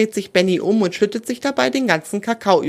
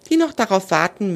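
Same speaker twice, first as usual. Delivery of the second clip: ordinary delivery, nothing marked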